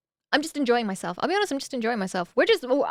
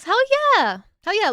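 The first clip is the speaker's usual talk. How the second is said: much higher, louder whoop; excited cheer; 9 semitones higher, 7 dB louder than usual. Real, not acted. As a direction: cheer with joy.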